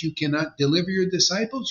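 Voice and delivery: ordinary talk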